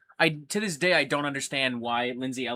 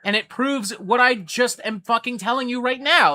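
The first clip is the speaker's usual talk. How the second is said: higher, louder, emphatic and fed up; retold exasperation; 9 semitones higher, 7 dB louder than usual. Pointing at his own quote to an accuser, loud and exasperated. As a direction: hammer the point home, annoyed. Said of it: "and I'd go".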